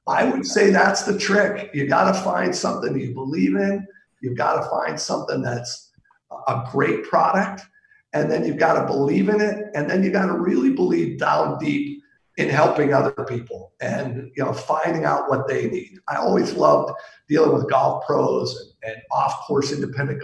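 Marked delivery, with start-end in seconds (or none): none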